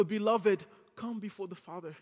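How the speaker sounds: ordinary delivery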